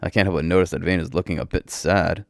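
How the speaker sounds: usual speaking voice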